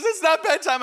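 high, loud mock protest; panicked child. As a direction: imitate a kid yelling back in a panic. Son's panicked reply retold.

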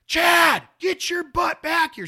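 loud high yell on the name; mom yelling a name. Retold or imitated. Mom yelling her son's name back from the street. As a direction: yell it like a mom whose kid ran into traffic. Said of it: "She's gonna go".

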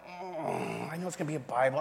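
soft, doubtful hum to himself; retold wary thought, under the voice; reluctant grumble. Newcomer dreads a Bible study, soft reluctant aside.

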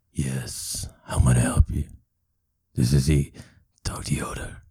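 deep doctor voice. Acted. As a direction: answer the phone gruffly and low. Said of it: "doing both voices".

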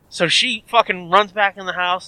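usual speaking voice

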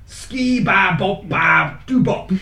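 slow, deliberate syllable by syllable; slow recital. Shown as a demonstration. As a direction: read it out very slowly. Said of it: "I have to say it again".

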